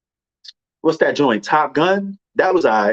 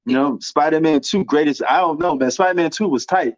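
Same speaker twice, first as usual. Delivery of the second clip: usual speaking voice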